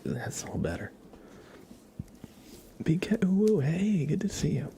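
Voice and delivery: ordinary talk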